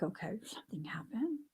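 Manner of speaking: hushed whisper to herself; whispered aside. Retold or imitated. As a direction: breathe it out, unvoiced, as a private thought. Mom's private thought on pickup.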